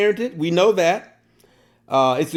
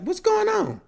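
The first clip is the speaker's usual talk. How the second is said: put-on panicked child; 5 dB quieter than usual. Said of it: "she's all panicking".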